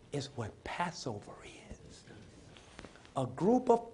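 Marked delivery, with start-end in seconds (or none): 0.1-2.0 dropped to a slow whisper; sudden whisper. Acted. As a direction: pull them in with a hush. Sudden hush after loud line.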